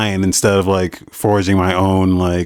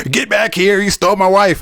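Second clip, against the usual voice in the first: higher, lightly voiced angry yell; mock angry stranger; 10 semitones higher than usual. Stranger in the forest yelling at the player, mock angry. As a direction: bark it like a jealous husband. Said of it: "some asshole's like".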